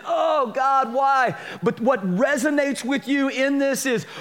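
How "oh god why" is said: high, raised cry to God; anguished cry. Retold or imitated. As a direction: cry out in pain. Crying out in grief.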